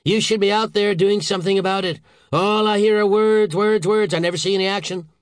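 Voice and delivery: loud, high impatient tirade; angry snap. A narrator's reading. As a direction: berate him for all talk. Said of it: "snapped the Secretary".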